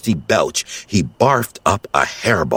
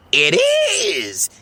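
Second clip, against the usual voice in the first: cheering. Acted. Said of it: "he cheered".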